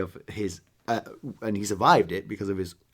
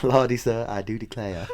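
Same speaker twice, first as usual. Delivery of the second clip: Scarlett O'Hara. Acted. Joking about his American accent, mock Southern belle. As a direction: play a Southern belle, amused. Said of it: "um, Scarlett O'Hara".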